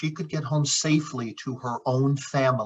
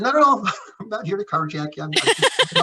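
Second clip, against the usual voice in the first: nervous laughing reassurance; 8 semitones higher, faster than usual. Retold or imitated. Reassuring a stranded stranger, laughing.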